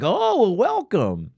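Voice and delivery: high, slow, gushing welcome; mock bubbly welcome. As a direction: overdo the sweet hello. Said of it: "not just a bigger hello".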